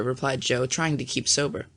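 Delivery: usual speaking voice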